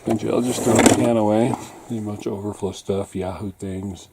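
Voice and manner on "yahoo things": ordinary talk, nothing marked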